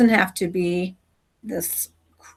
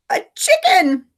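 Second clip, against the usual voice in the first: gasp, then a high, slowed cry; shocked at what's on the path; gasped surprise; 12 semitones higher than usual. Acted. Samson spots a chicken, gasping in surprise.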